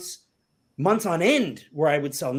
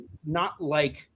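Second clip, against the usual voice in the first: ordinary talk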